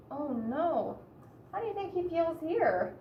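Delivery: plain reading voice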